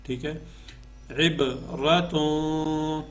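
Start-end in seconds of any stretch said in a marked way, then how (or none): 1.3-3.0 عبرتوں slow, bouncing the b, humming the nasal ending; qalqala and ikhfa. Shown as a demonstration. Teacher demonstrating the rules, drawn-out عبرتوں with nasal hum. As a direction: hold the final nasal of عبرتوں long. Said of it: "یہاں پہ ہم جو ہے اخفا کریں گے".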